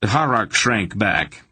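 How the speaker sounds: ordinary delivery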